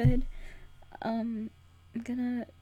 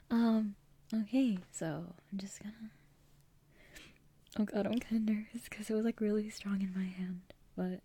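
whispering. Real, not acted. Whispers throughout.

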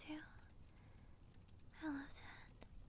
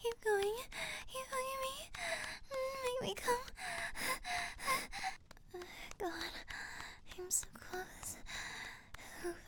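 mounting breathy urgency, pitch raised; breathless pleading; 5 semitones higher than usual. Acted. Mounting passion in intimate scene.

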